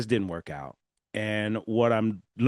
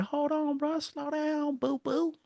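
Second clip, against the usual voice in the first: future self voice; slower than usual. Retold or imitated. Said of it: "my future self was like".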